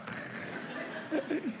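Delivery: breathless, high, soft laugh; a quiet wheezing giggle; airy laugh. Real, not acted. Pastor cracks up at the boy's honest answer, breathless laughter.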